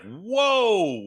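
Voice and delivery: a high, stretched mock "Whoa"; dazed whoa. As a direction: stretch it out like your head is spinning. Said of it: "And he's like".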